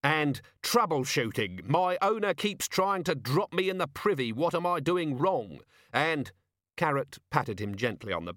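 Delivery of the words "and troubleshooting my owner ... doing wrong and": high, worked-up sarcasm; wild-eyed rant. A narrator's reading. Vimes dictating a mock manual, wild exasperated rant. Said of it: "his eyes wild".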